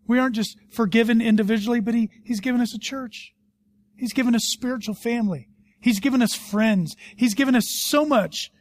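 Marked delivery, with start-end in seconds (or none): none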